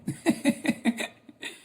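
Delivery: high, loud burst of laughter; emotional laugh. Real, not acted. Friend moved by a kind officer's video, high emotional laugh. Moved reaction to a touching clip.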